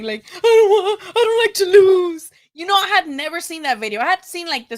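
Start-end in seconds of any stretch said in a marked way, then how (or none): 0.3-2.3 high, loud wailing mock sob; mock crying whine. Mocking Shakur's crying in the video, loud high wail. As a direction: whine it like a kid bawling. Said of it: "video of Shakur Stevenson crying".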